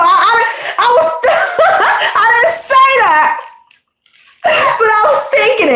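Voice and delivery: high-pitched, cracking up through the words; laughing. Real, not acted. Admitting to viewers what she secretly thought, laughing. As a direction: giggle helplessly while owning up. Cracks up correcting her own quote.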